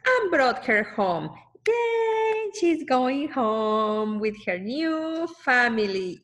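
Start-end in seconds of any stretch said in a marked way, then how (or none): 1.7-4.2 excited cheer. Acted. Celebrating Lucy going home.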